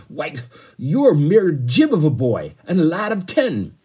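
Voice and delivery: loud, high, scornful outburst; angry bellow. A narrator's reading. Captain scorning a mere boy of ten, angry bellow. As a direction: roar it in red-faced indignation. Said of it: "he bellowed, and his plump nose was red with anger".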